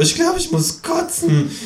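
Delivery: very high kangaroo voice, a touch queasy; about to be sick, high and squeaky. A narrator's reading. Drunk kangaroo feels sick, in its high voice.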